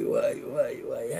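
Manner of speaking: the ouai words whispered, voice dropped away; awed whisper. Real, not acted. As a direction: breathe ouai ouai in quiet wonder. Same words drop to whisper.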